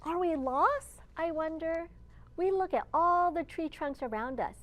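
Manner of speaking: plain reading voice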